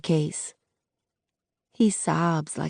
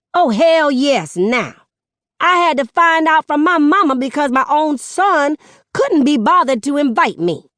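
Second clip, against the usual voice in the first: much higher, loud outraged mother's voice; furious mom, voice pitched up and loud; angry mother; 10 semitones higher, 6 dB louder than usual. Acted. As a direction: rant at him like the mother who raised him. Mother scolding son in public.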